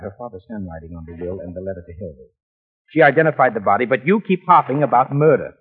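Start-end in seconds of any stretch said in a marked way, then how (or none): 2.9-5.5 loud, raised-pitch challenge; exasperated, forceful interrogation; accusing. Acted. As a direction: press the suspect hard. Investigator confronts suspect.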